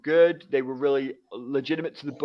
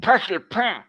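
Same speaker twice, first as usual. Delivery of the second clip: imitated Quebecois French, higher pitched; Quebecois French accent; 6 semitones higher, 4 dB louder than usual. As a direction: mimic a friend's Quebecois pronunciation of French. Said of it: "the way he would pronounce everything was so different".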